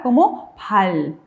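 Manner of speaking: pal said slowly, leaning on the final l; slow demo. Shown as a demonstration. Teacher modelling the batchim sound for students, slow pal. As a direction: draw out pal so the l is heard. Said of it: "Si va a ser sonido L".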